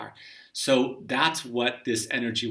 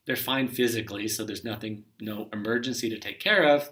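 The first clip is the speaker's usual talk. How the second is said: normal talking voice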